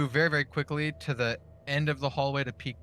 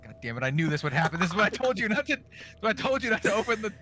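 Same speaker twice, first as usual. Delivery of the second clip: rising, higher-pitched grumble; mild exasperation; 5 semitones higher than usual. Acted. Player's character fuming inwardly over opened doors, exasperated. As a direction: complain as if nobody listened to you. Character's annoyed inner thought.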